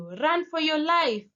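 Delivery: high urgent shout; shouted warning. Retold or imitated. Stranger shouting at you in the dark, high urgent yell. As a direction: cry it out with intensity. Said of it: "just randomly shouts at you".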